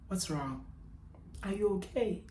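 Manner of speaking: very soft, tender check-in; hushed, caring question; gentle concern. Retold or imitated. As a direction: ask it softly, as if to someone upset. Acts out his concerned question.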